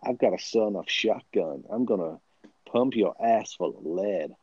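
mock American action-movie talk; American accent. Podcaster threatening co-host like an action hero, mock American accent. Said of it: "let's try and talk American".

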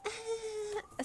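high, held mock-laugh wail; one long high-pitched note mimicking her laugh; imitated obnoxious laugh. Imitating a friend's very loud laugh, high held squeal. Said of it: "something, like, very loud".

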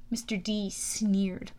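usual speaking voice

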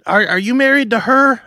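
mock comic firing questions at the crowd; prying opening comics. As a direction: imitate comics grilling the audience. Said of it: "they were literally going around".

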